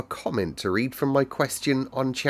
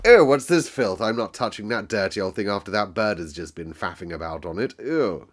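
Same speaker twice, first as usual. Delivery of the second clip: disgusted; 4 dB louder than usual. Retold or imitated. Old grumpy Mary spurning the key, loud mock disgust. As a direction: sneer at the dirty old key. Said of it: "old Mary ... had gone".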